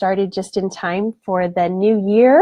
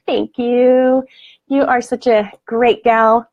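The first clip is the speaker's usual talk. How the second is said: ordinary talk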